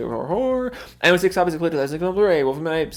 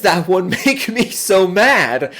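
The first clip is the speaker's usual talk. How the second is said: louder, slow mock outrage; mock fury; 3 semitones higher, 4 dB louder, slower than usual. Real, not acted. Streamer fuming over his own typo, hammed-up and slow. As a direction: rant about the mistake in exaggerated anger. Angry at spelling mistake.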